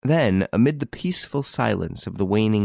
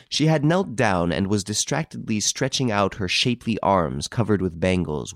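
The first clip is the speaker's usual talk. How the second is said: usual speaking voice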